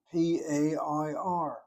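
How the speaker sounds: slow, letter-by-letter spelling; deliberate spelled-out letters; careful spelling. Shown as a demonstration. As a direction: spell it out slowly, each letter on its own. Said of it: "I've gotta be careful".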